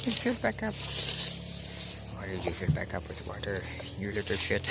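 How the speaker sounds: teasing cuss with no real anger; playful, affectionate swearing; playful scolding. Real, not acted. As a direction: playfully tell it off. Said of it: "said playfully".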